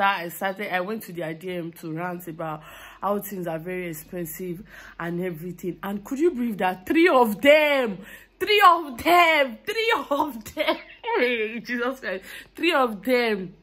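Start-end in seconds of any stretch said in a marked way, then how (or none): 5.8-13.5 can't believe it, slow and rising with joy; overjoyed disbelief. Real, not acted. Friends gave her money.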